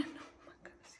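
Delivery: hushed giggle, almost silent; whispered laugh. Real, not acted. Cat purring in her arms.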